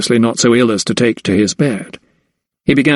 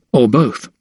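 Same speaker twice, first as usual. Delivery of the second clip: usual speaking voice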